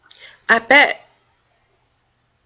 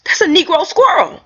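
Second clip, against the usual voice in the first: can't believe your eyes; rising amazement, a laugh in it; excited disbelief; 8 semitones higher than usual. Retold or imitated. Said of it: "I said".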